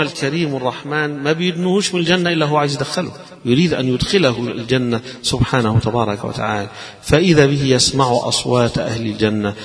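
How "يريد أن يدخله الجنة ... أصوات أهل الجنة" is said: ordinary talk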